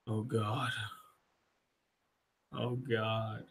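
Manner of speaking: low, very soft acted murmur; hushed imitation of quiet devastation; stunned despair. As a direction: murmur it slowly, as if defeat is sinking in. Retelling Captain America's stunned despair.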